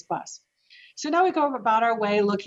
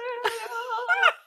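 high laugh; 4 dB quieter than usual. Real, not acted. Laugh after the punchline.

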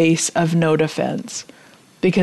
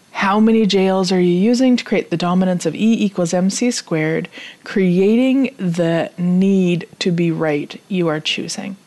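normal talking voice

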